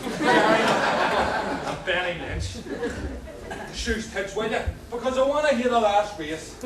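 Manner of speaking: loud laughing call. Acted. Yelling to hear the race.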